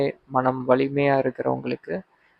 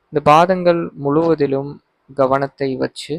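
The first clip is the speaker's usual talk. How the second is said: usual speaking voice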